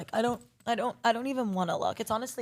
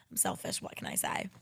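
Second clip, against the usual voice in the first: much lower, whispered shrug; low sheepish whisper; 11 dB quieter than usual. Real, not acted. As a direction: mutter the admission under your breath. Self-deprecating aside.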